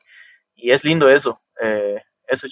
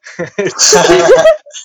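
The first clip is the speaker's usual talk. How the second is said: loud burst of laughter; 6 dB louder than usual. Real, not acted. Laughs at two-year podcast.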